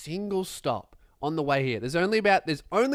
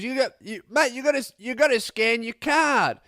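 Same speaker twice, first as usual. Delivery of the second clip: insistent bus driver; 6 semitones higher than usual. Retold or imitated. Bus driver calling after a passenger, raised insistent order. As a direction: call him back firmly. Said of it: "He goes".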